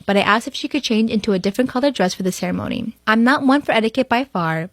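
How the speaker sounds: normal talking voice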